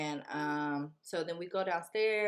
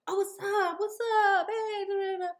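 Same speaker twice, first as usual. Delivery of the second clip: mock bro greeting; 7 dB louder than usual. Guy greeting the doorman.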